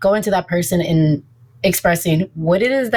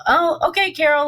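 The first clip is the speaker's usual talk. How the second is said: dismissive okay; 9 semitones higher than usual. Retold or imitated. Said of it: "you know".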